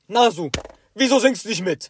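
loud, high call across the room; the music teacher calls out to Nasu, loud and high. Retold or imitated. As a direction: call out to the kid who isn't singing. Teacher calling out to student.

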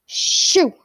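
loud shoo. A narrator's reading. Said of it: "said Mister Dursley loudly".

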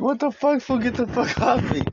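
very high-pitched mock outrage; retold alarmed protest. Retelling being bitten by him, high alarmed protest. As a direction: act out a high, indignant protest.

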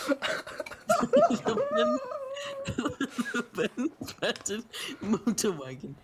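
breaking into helpless laughter; can't stop laughing mid-sentence. Real, not acted. Cracking up reading question.

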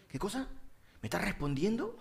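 softly imitated scolding question; mild mock reproach. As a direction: retell the challenge quietly, voice rising at the end. Imita al presentador reprendiendo a la señora. (reproche imitado en voz baja)

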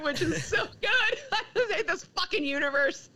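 laughing while quoting it; giggling through the line, high and breaking. Real, not acted. Fan laughing as she repeats the line. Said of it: "that's so relatable".

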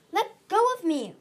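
high, loud refusal; angry growl. A narrator's reading. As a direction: snap it out to shake someone off. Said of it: "Orchard growled".

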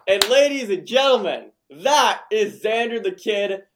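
loud, high, drawn-out introduction; hyped announcer. Real, not acted. Host presents the guest after his rap, hyped announcer. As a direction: present the performer like a ring announcer.

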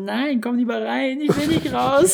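high, pleading little voice; whiny self-quote. Retold or imitated. Boyfriend begging girlfriend to stay inside, high pleading voice. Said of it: "Und ich sag so".

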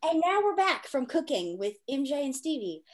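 announcer voice. Acted. Podcast host announcing segment.

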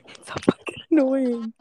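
usual speaking voice

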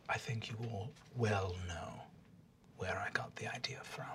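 hint darkly in a whisper; knowing whisper. Acted. Captive sister whispering an accusation at her sibling. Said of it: "looks at you and says".

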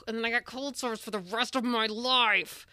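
playing up how pissed you'd be; mock angry outburst. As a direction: build to a hammed-up indignant yell. I'd be angry.

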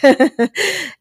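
high mock giggle, imitating Luke; a quick put-on giggle; imitated giggle. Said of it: "He's like".

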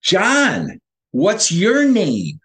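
high, slow, insistent question; alarmed friend, retold. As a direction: spell it out urgently to someone who is confused. Friend's alarm at confused man.

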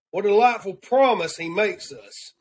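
normal talking voice

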